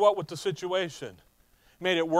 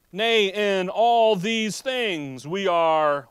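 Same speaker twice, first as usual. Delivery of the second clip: slow, loud, stretched preaching read; drawn-out reading; 7 dB louder, much slower than usual. Shown as a demonstration. Preacher slowly reading verse to congregation, drawn out. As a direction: stretch every word of the verse out. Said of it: "notice how".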